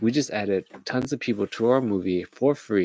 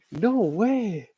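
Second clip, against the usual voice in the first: very high, drawn-out disbelief; mock amazement; 11 semitones higher than usual. Another onlooker in the reaction cut, mock amazement. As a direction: float it up high in hammed-up awe. Acted crowd reaction shot.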